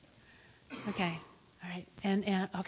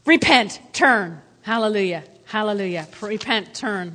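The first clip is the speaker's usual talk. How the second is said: preacher exhortation; 3 semitones lower, 5 dB louder than usual. Acted.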